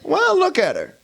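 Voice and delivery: high, loud protest: "Well, look at her"; indignant kid. Acted. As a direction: defend yourself like a cheeky child. Kid defends insult, loud.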